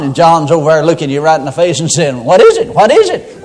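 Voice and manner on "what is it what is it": higher, louder impatient answer; exasperated reply. Acted. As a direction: answer someone who keeps calling you needlessly. John answers repeated pointless calls.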